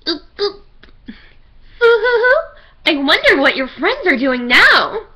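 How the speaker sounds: high, taunting cartoon bear; sing-song creepy mascot taunt; Monokuma voice.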